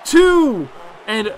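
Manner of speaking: loud, high wrestling commentary; excited play-by-play. Pretend. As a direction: call the pinfalls at full shout. Live wrestling play-by-play at full excitement.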